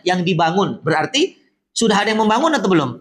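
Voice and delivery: usual speaking voice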